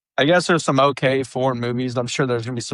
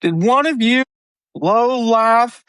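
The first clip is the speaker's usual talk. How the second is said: gangster's outrage; 10 semitones higher than usual. Retold or imitated. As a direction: bark it out like an offended gangster. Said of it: "And he goes".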